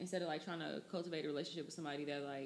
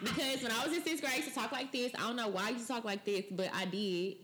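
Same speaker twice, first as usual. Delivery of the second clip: nasal, higher-pitched schoolgirl voice; imitating a sassy nasal sixth grader; sixth-grade self; 4 semitones higher than usual. Shown as a demonstration. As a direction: put lots of nasal in it, like your younger self. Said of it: "put a lot of nasal in my voice".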